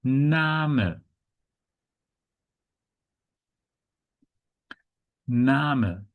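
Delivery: "Name" as German NAH-muh; German pronunciation. Shown as a demonstration. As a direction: say "Name" with a long AH and a sounded final vowel. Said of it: "So this is pronounced".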